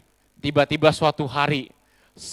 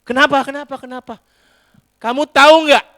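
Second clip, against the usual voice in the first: high, loud indignant scolding; angry husband; 9 semitones higher, 10 dB louder than usual. Acted. Husband scolds his wife over the meal, loud and indignant. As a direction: scold her, all worked up.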